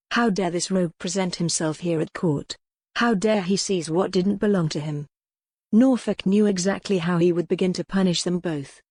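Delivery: ordinary delivery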